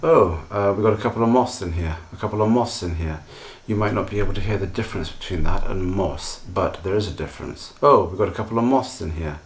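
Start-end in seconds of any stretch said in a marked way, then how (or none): 0.0-3.2 "moths" run together so it sounds like moss; quick casual speech. Shown as a demonstration. Teacher showing how natives say it quickly: rushed moths. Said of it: "If we're speaking quickly". 7.8-9.4 "moths" blurred to sound like moss; quick casual speech. Shown as a demonstration. Teacher repeating the fast version for learners: blurred moths. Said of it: "It's very quick".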